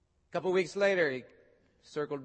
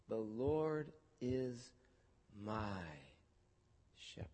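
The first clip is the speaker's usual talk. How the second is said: hushed reverent reprise; 6 semitones lower, 11 dB quieter than usual. Acted. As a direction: let each word fall into silence. Tender closing reprise of psalm.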